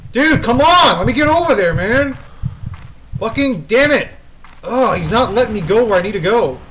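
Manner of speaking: loud, high frustrated complaint; exasperated shouting. Real, not acted. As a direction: plead and rage at the one blocking you. Blocked by game pursuer.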